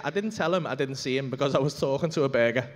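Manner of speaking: ordinary talk, nothing marked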